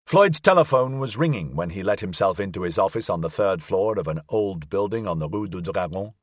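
ordinary delivery

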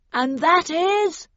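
loud, slow call through a megaphone; bullhorn voice. A narrator's reading. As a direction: boom it out over a bullhorn. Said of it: "came the voice on the bullhorn".

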